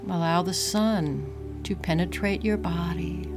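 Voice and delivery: calm, unhurried breathing guide; guided meditation. Acted.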